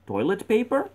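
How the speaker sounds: high, sharply rising question; puzzled question. Acted. Other guy answering about newspapers, high puzzled question. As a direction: lift "paper" way up in mock puzzlement.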